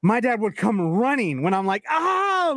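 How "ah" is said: short high mock "ah" of pain; retold kid scream. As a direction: imitate a kid yelping at a stubbed toe. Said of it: "like, screaming as a kid".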